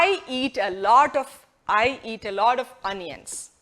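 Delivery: very slow, carefully spaced; slow model sentence. Shown as a demonstration. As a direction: read it slowly for learners. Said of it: "ഒരു സെന്റൻസിൽ പറയാമെന്ന് നോക്കാം".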